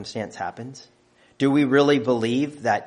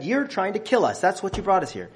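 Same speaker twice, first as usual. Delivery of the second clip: higher, acted-out accusing whine; the crowd turns on Moses, higher mock blame; complaining accusation; 8 semitones higher than usual. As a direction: blame him as the grumbling crowd. People accusing Moses, quoted.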